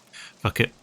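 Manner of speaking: usual speaking voice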